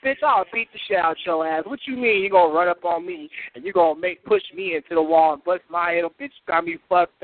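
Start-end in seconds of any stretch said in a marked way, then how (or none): none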